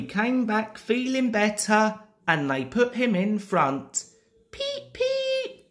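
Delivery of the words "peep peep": very high piping peeps; Edward's whistle. A narrator's reading. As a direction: squeal like a small engine whistle. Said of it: "said Edward".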